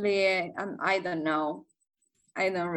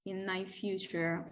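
usual speaking voice

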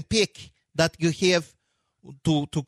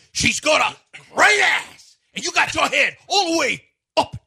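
Al Pacino; 10 semitones higher, 3 dB louder than usual. Acted. Actor quoting his big character, hammed-up high shout. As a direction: bellow it like an over-the-top movie character. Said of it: "My characters are all big".

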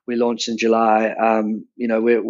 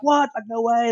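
freaking out; 11 semitones higher than usual. Retold or imitated. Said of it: "just freaking out".

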